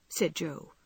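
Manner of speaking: plain reading voice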